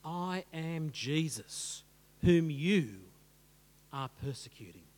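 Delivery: slow, low, solemn declaration; voice of Jesus. Acted. Jesus confronting Saul with the truth, slow solemn voice. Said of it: "when he hears those words".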